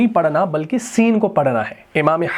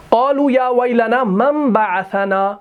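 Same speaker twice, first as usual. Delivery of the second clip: Quran recitation; 4 semitones higher than usual. Shown as a demonstration. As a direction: recite the ayah in a melodic chant. Reciting the ayah.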